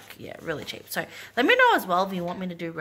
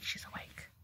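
whispering; 17 dB quieter than usual. Real, not acted. Whispered aside.

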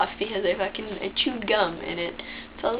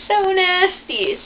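grossed out; 11 semitones higher, 8 dB louder, slower than usual. Real, not acted. Disgusted about grandma scooping poop.

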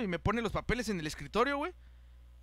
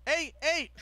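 higher-pitched mock calling out; imitated call-out; 7 semitones higher than usual. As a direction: hail someone from behind, sharply. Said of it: "Cuando de repente oímos". (imitando un llamado de atención)

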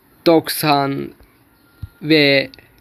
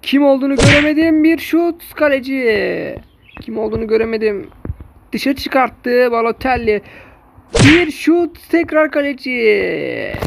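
play-by-play commentary; 5 semitones higher than usual. Acted. Live match play-by-play.

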